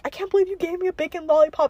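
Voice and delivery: high, whiny mock accusation; imitated outrage. Popular girl blaming the lollipop giver, mock-outraged. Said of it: "she was also looking at me".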